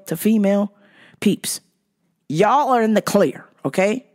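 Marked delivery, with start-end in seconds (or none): none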